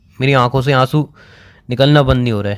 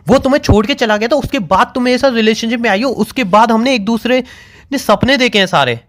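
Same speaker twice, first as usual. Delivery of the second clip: high-pitched, hurt disbelief; wounded, pleading argument pitched high; upset protest; 7 semitones higher than usual. Real, not acted. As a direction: protest in a raised, agitated pitch. Hurt, upset confrontation on call.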